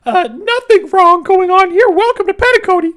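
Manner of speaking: very high, loud cartoon voice; high-pitched loud mascot greeter; Clocky. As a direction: squeal it out way up high, stretching the words. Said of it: "I can do Clocky".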